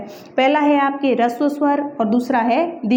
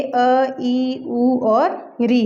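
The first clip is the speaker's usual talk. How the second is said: each vowel drawn out slowly; teacher says ई, उ, री slowly to the class; slow listing of vowels; much slower than usual. Shown as a demonstration. Teacher slowly spelling out the basic vowels. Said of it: "ये जो मूल स्वर है".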